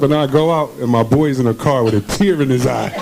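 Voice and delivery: normal talking voice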